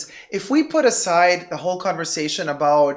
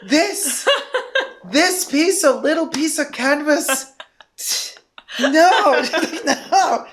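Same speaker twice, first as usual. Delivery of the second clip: higher, scornful and laughing; disgusted refusal; 7 semitones higher, slower than usual. Retold or imitated. Friend mocking the pricey canvas, disgusted laughing scorn. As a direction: sneer at the tiny canvas bag. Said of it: "she literally had a disgusted face".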